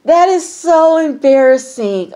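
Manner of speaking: high, drawn-out wail; cringing hilarity. Real, not acted. Host cringing for the painter, wailing in secondhand embarrassment. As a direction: stretch it out, cringing after a laugh. Cringing after a laughing fit.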